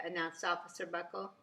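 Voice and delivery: plain reading voice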